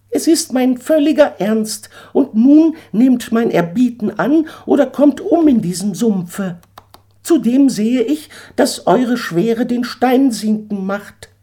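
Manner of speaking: a proud eagle laying down terms; haughty eagle. Acted. Eagle urges Daniel off the sinking stone, high and haughty.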